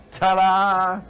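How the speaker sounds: loud high showbiz ta-da; family proudly ending their act with a loud high ta-da. Acted. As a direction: throw it out like a grand finale.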